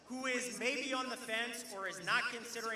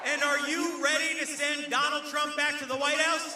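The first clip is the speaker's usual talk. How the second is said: rising, louder crowd call; rally call and response; 4 semitones higher, 6 dB louder than usual. Real, not acted. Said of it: "a little call and response".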